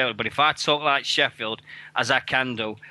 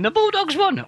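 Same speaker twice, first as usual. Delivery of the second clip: much higher, louder ecstatic cheer; crowd going mental; 4 dB louder than usual. Retold or imitated. Fans going mental as the Bulldog wins, ecstatic shout. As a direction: yell it like the whole room erupting. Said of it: "everybody went mental going".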